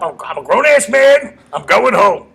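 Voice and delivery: high, loud retold outburst; defiant drunken-boss shout. Comic imitating his boss refusing to be talked round, defiant shout. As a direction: imitate an indignant, stubborn yell. Acted quote of Artie arguing back.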